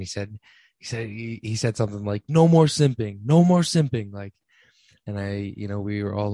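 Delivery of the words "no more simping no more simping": louder, higher mock chant; imitated rallying call. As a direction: call it out like a friend's slogan. Quotes friend's rallying catchphrase.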